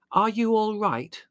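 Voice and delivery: wide-eyed concern, pitched right up; concerned girl. A narrator's reading. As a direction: ask like a worried sister. Margot asks muddy brother anxiously.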